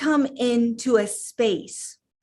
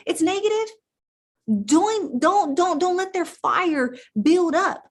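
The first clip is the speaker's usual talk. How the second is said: much higher, fervent call to stand up; fired-up rallying; 7 semitones higher than usual. Real, not acted. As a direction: rouse the crowd like a coach at halftime.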